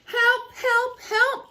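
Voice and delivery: high, urgent cry for help. Acted. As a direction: call out like a stuck little truck. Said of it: "cried the little blue truck".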